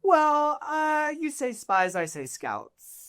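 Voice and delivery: sidestep the word delicately; coy evasion. Acted. Noble asked about spies around the city, coy.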